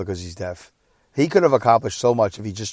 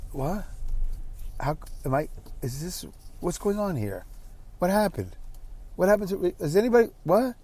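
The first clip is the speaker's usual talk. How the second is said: higher, softer, halting disbelief; bewildered; 7 semitones higher, 8 dB quieter than usual. Retold or imitated. Son recalling being stunned by dad's refusal, bewildered. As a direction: piece out small, stunned questions.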